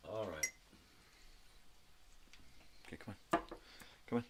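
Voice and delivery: ordinary talk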